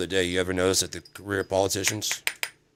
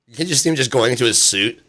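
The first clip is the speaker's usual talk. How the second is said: ordinary talk, nothing marked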